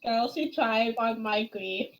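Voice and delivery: usual speaking voice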